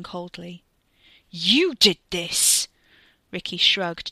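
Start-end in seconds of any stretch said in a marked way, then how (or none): 1.3-2.7 loud, slow, drawn-out accusation; seething, heavily weighted charge; furious accusation. A narrator's reading. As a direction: hurl the blame at him, word by word. Wes accuses Ricky.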